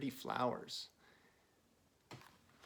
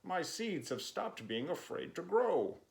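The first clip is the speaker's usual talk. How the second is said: excited Toad; 7 semitones higher than usual. Acted. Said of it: "shouted Toad".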